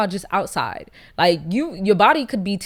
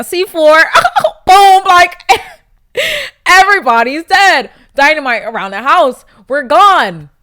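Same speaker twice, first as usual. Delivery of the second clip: much louder, hyped-up joking; mock explosive glee; 6 semitones higher, 12 dB louder than usual. Joking about blowing up the house, loud hammed-up glee. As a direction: ham up the boom for the laugh.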